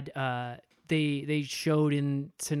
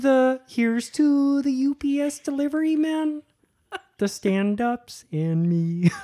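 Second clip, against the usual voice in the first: cartoon voice; 9 semitones higher, 6 dB louder than usual. Comic voice toast.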